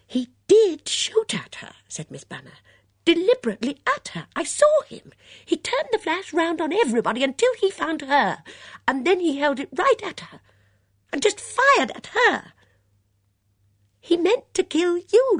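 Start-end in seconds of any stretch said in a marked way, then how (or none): 0.1-1.7 high, loud, slow emphatic protest; agitated insistence. A narrator's reading. Friend insists to inspector it was deliberate, agitated. 3.0-15.4 very high, loud, worked-up outburst; agitated insistence. A narrator's reading. Friend tells inspector what she saw, agitated and insistent.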